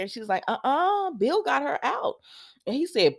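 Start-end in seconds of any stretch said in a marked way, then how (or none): 0.5-2.2 high-pitched mock correction; imitating a juicy rebuttal; retold contradiction. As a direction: correct him with a high, gossipy lift.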